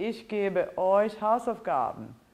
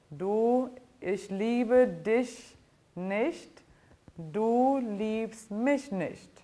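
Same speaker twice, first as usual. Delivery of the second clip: deliberately slow, every word separate; slow dictation-style reading; slow, clear teacher demo; 2 semitones higher, much slower than usual. Shown as a demonstration. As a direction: spell it out slowly for the class. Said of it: "teacher spells out song line slowly".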